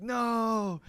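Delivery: long, high, loud no; imitated chorus of protest; crowd's retold objection. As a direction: drag out a loud, high correction like a whole crowd. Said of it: "And everyone's like".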